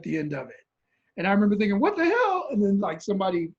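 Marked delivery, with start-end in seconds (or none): 1.8-2.5 high-pitched startled exclamation; shocked disbelief. Retold or imitated. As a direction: blurt it out in alarmed disbelief. Acts out shock at seeing leg.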